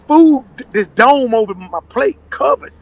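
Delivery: usual speaking voice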